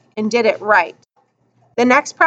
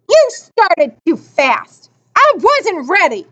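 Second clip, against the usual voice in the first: angry protest; 4 semitones higher, slower than usual. A narrator's reading. Sore loser shoves and accuses starter.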